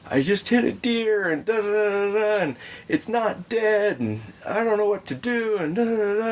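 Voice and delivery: high-pitched mock panic, tumbling into da-da-da; distraught man. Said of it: "like, distraught".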